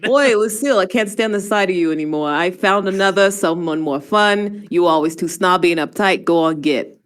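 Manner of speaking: loud, brash New York brush-off; New York accent. Acted. Man's breakup note to Lucille read aloud, brash New York accent. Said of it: "I'll try to do, uh, New York".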